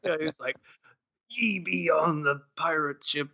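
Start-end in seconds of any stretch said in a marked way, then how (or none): 1.3-3.3 lightly voiced pirate, slow and higher; pirate impression voice. Player imitating the escape room host, mock pirate. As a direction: imitate a pirate, stretching the words. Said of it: "all of a sudden was a, a pirate".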